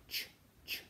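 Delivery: ch as a bare English CH; soft C sound. Shown as a demonstration. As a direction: isolate ch, ch without a vowel. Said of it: "C before E and C before I sound like".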